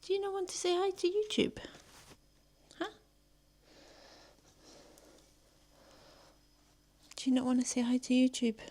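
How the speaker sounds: high, fast cooing at a pet; pet talk. Real, not acted.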